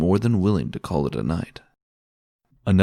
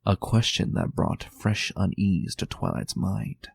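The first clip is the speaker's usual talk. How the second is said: plain reading voice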